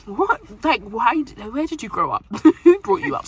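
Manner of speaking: loud, incredulous teasing; mock outrage. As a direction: act shocked at her taste. Mock outrage about food taste.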